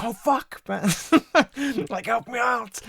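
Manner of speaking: hammed-up childlike whine for help; pleading to mum. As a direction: play the helpless son calling his ma.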